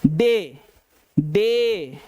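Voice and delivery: डे said alone and clearly, stressing the ए sound; teacher demonstrating the vowel in डे; showing the word. Shown as a demonstration. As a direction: say डे cleanly for the class. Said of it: "ए का उच्चारण आ रहा है".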